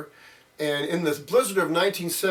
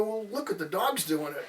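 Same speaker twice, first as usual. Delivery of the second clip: put-on small high kid voice, softer; imitating little kid; 5 semitones higher, 5 dB quieter than usual. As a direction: answer like an innocent little boy. Child answering mother in story.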